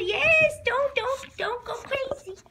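loud, high yelp of a warning; real excited shout at the dog. Real, not acted. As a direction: burst out high as the dog jumps. Dog lunging for cookie.

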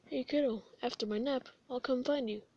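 ordinary talk, nothing marked

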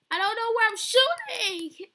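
high, loud complaint; panicked wail. Real, not acted. Shooting wildly while chased.

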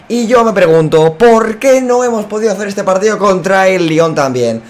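ordinary delivery

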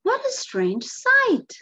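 high, loud, drawn-out acted amazement; amazed townsfolk. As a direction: marvel slowly at a strange sight. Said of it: "The townsfolk say".

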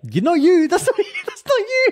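shrieking in disbelief; high teasing shriek. Real, not acted. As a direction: squeal the protest up high. Teasing friend, laughing disbelief.

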